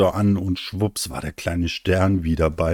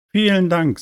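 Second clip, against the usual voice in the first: sehr hohe Kinderstimme; dankbarer kleiner Stern; 12 semitones higher, 4 dB louder than usual. Acted. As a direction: bedank dich hoch und kindlich. Kleiner Stern dankt der Wolke. (kindlich dankend)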